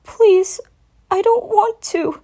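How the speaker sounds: high, slow whimpering plea; tearful little dragon. A narrator's reading. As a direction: whimper like a scared child. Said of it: "tried to speak in a whimper".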